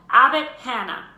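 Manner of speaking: loud roll call across the hall; calling out a name. A narrator's reading. Teacher calling out names.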